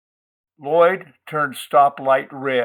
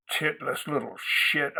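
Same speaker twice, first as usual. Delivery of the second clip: much softer, bitter mutter under the breath; muttered curse; 8 dB quieter than usual. Acted. Narrator cursing Gwendy to himself, soft bitter mutter. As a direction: spit it under your breath. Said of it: "I muttered".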